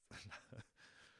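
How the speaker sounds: a soft, voiceless giggle he can't hold in; breathless giggle. Real, not acted. Too amused by the name to say it, a stifled whispery giggle. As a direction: giggle under your breath, barely able to speak. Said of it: "oh, I can barely say it".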